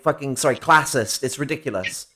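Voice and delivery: ordinary delivery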